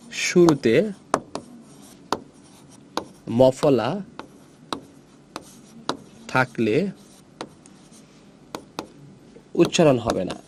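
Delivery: one word at a time, long pauses; dictation pace. Shown as a demonstration. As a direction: dictate slowly, leaving time to write. Teacher dictates rule with long gaps. (থেমে থেমে শ্রুতলিপির মতো)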